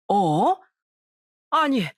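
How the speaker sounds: mildly startled double-take; understated shocked recognition. A narrator's reading. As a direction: react with a small jolt of surprise. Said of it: "깜짝 놀라더라고요".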